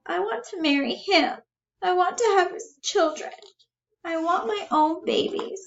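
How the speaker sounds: high, tearful longing; high-pitched weepy declaration; acted tearful. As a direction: plead high, on the edge of crying. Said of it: "She starts to cry".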